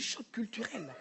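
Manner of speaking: put-on soft, slow standard French accent; accent perdu. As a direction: quietly savour the newly refined French accent. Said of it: "j'ai perdu mon accent". (accent français châtié, doucement étiré)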